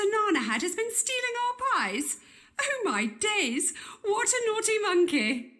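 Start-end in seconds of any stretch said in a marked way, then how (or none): 2.6-5.5 shocked exclamation. Acted. Shocked at monkey's guilt.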